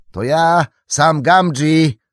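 high, loud drawn-out hail; calling out. A narrator's reading. Sam hails the Cottons at a gallop, calling out. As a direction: shout your name while still riding up. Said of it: "zawołał w pędzie jeszcze Sam".

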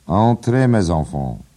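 loud, high call of welcome. Acted. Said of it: "zawołał Lenehan".

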